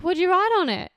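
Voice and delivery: high, loud eager demand. Retold or imitated. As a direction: jump in, loud and eager to know. Excited demand for postcard.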